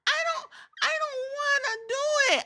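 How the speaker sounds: very high tearful whine; high crying refusal. Retold or imitated. Kairi refusing Asuka, very high crying whine. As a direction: cry out the refusal like a scared kid.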